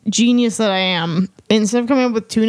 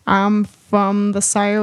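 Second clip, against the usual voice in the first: slow, badly faked Southern drawl; Southern accent; slower than usual. Shown as a demonstration. Host tries after being challenged, slow bad Southern accent. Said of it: "Can you do Southern accent if you try?".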